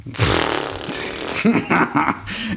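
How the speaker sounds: high, uneasy giggle; nervous laugh. Real, not acted. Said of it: "That's my nervous laugh".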